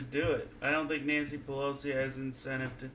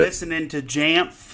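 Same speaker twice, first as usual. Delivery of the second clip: louder, clearer repeat; 3 semitones higher, 10 dB louder than usual. Real, not acted. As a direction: says it again, louder. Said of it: "you sound so much better now".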